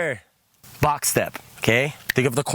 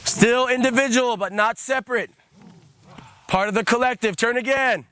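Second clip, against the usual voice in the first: raised, measured guiding voice over the chant; guided trance leading; 7 semitones higher than usual. Acted. Leads a trance movement.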